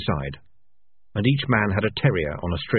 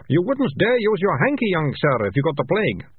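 rat catcher; 9 semitones higher than usual. A narrator's reading.

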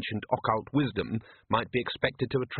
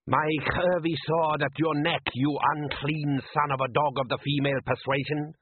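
Klatchian fisherman; 4 semitones higher than usual. Acted. Arif's reply in quarrel.

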